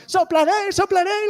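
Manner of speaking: high excited shout of the wolf's line; children shouting. Retold or imitated. Children in the audience shouting along. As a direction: yell it out like a kid joining in. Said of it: "you were shouting".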